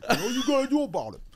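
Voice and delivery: put-on high, childlike pride; goofy comic crowing over his achievement; hammed-up proud impression, swooping up; Tracy Morgan. Shown as a demonstration. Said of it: "he's so fucking proud of himself".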